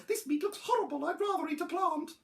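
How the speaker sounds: high little dinosaur voice; high-voiced picky child; fussy youngster. A narrator's reading. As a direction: protest in a small, high voice. Young dinosaur refusing food in story.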